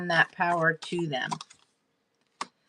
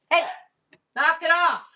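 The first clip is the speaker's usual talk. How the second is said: sharp scolding shout at the dogs; loud sharp voice; 9 semitones higher, 3 dB louder, faster than usual. Real, not acted. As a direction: snap it out, high and loud. Don't make me get my mom voice going.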